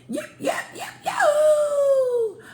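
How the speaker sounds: very high, drawn-out whooping yelp; coyote yelp. Acted. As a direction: howl it out like a scorched coyote. Coyote's howling yelp escaping.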